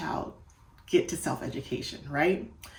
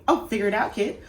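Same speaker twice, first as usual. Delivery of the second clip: brush-off, a bit louder and higher; dismissive teacher; 4 semitones higher, 5 dB louder, faster than usual. Shown as a demonstration. Teacher brushing off a struggling child, mildly impatient tone. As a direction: toss it off impatiently. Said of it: "don't just say".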